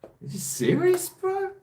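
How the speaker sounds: incredulous high squeal of disbelief; shocked, pitch shooting way up; surprised. Real, not acted. Disbelief at liking dinner rolls.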